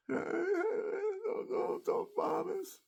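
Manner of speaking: very high, slow, breathy mock plea; imitating begging. Veteran imitating a father begging not to be bombed, very high thin plea. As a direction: beg in a thin strained squeak, voicing the family. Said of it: "And they're begging".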